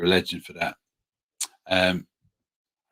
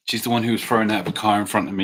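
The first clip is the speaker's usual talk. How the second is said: normal talking voice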